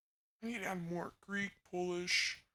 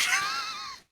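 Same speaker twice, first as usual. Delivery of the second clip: high laugh; 7 dB louder than usual. Real, not acted. Laughs after teasing chat.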